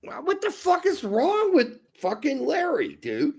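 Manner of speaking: high-pitched exasperated protest; indignant protest. Real, not acted. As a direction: protest as if wrongly accused. Protesting a listener's accusation.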